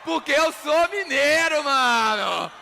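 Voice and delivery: high, loud, slow proud holler; triumphant boast to the crowd; proud shout. Pretend. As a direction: shout it out with drawn-out pride. Proud punchline shouted to audience.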